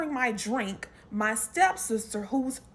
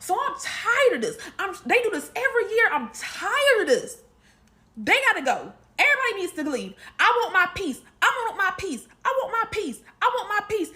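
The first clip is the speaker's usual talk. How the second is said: loud, higher exasperated complaint; fed-up rant; 8 semitones higher, 5 dB louder than usual. Retold or imitated. Mom ranting that she wants her peace, loud and fed up. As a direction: vent like you've had enough.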